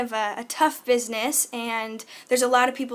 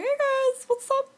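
much higher, squeaky hey guys; 13 semitones higher than usual. Acted. Showing the silly voice she uses with friends, high and squeaky. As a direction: pitch way up into a cartoon squeak. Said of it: "and I have that voice".

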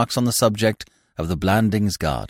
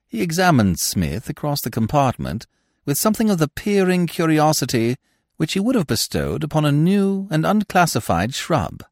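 plain reading voice

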